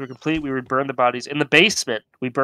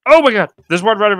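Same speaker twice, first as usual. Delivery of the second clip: loud, high alarmed cry; frightened shout; 8 semitones higher, 9 dB louder than usual. Real, not acted. Doll runs past teammate.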